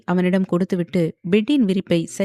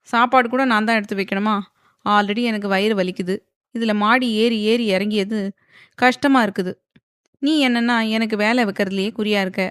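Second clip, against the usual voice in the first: ordinary delivery, nothing marked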